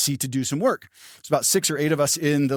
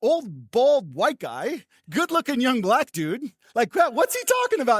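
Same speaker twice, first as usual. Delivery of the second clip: much higher, amused mock bewilderment; voicing the baffled room, pitched up; onlookers' thoughts; 7 semitones higher than usual. Waiting room people reacting to the remark, higher mock disbelief. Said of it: "starts cracking up 'cause they're like".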